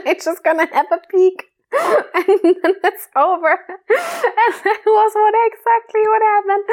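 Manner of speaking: high giggling voice; laughing. Real, not acted. Telling the story, laughing her ass off. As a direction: laugh it out helplessly. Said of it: "I'm laughing my ass off".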